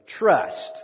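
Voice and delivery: "trust" long and loud; emphasis on trust. Shown as a demonstration. Preacher showing where the emphasis goes, heavy stress on "trust". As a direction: stretch out "trust" with force. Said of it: "Let's put the emphasis on trust".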